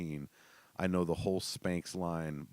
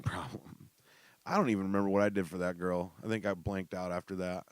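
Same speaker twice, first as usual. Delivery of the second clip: usual speaking voice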